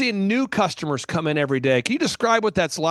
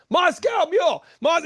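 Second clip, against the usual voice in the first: high shouted call passed down the crowd; shouted order; 10 semitones higher than usual. Retold or imitated. Patrons relaying the drink order through the crowd, shouting it. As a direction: call it out over a packed bar.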